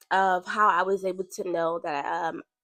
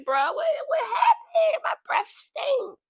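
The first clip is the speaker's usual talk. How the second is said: much higher, laughing shout, slow; mock shocked yelp; slower than usual. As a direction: squeal in comic disgust at your own breath. Acted shock at bad breath.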